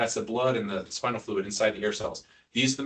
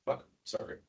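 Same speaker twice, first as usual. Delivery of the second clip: muttered curse; 10 dB quieter than usual. Real, not acted. Slip of a curse under breath.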